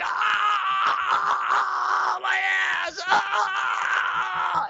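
very high, wailing cry of agony; crying out in pain. Retold or imitated. On the toilet after surgery, a high wailing cry of pain. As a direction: wail it like the pain is unbearable. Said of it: "I was like".

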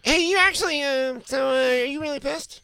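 high, hammed-up nervous voice; mock timid host. Host asking if she is really angry, high timid mock voice.

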